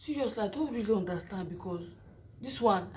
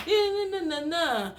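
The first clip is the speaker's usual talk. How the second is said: ordinary talk, nothing marked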